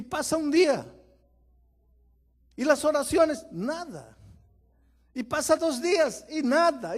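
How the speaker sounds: high, loud, hammering repetition; full-force preaching. Real, not acted. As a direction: pound each "nada" home. Preacher building tension.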